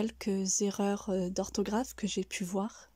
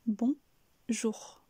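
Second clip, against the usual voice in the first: BON rising, pause, JOUR falling; two separate syllables, bon up and jour down; split rise and fall. Shown as a demonstration. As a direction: bon goes up, then jour comes down. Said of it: "Donc ce qui donne".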